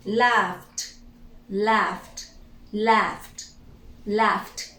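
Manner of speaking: "laughed" ending F then a released T; final T sound. Shown as a demonstration. Teacher drilling the simple past of laugh, final T sound. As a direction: say "laughed" as one syllable closed by T. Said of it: "at the end".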